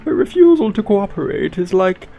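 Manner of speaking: high, stately royal voice; Queen of England voice. Acted. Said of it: "my Queen of England voice".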